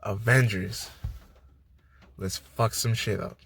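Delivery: a lightly voiced Captain America, low and soft; rallying hero line. Captain America rallying the Avengers before the battle, low rallying cry. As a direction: deliver the battle cry with low, quiet weight. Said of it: "Chris Evans finally gets to say this line".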